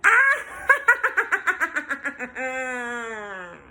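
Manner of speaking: loud evil cackle; witch cackle. Acted. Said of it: "The witch laughed with her typical laugh".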